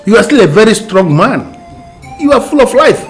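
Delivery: high, loud urgent pleading; pleading insistence. Acted. To her dying brother, loud high pleading.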